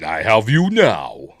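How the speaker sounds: lightly voiced gloating Vader. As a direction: drawl it like a gloating Dark Lord. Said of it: "so he goes".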